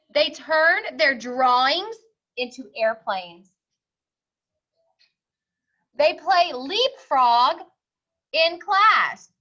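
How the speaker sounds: slow, firm no; stern principal. Acted. Principal refusing the pig entry, slow and firm. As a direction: lay down the rule slowly.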